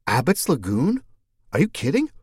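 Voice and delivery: surprised. Acted. Mercedes astonished.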